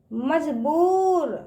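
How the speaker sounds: slow मजबूर, बू held very long; drawn-out long ū. Shown as a demonstration. Teacher drilling children, मजबूर with drawn-out ū. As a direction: stretch the ū in मजबूर. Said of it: "इसका उच्चारण ना खींच के करते हैं".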